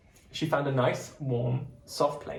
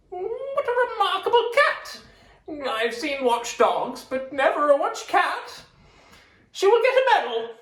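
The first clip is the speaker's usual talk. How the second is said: policeman voice; 6 dB louder than usual. Acted. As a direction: boom it out like a jolly bobby. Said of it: "He said".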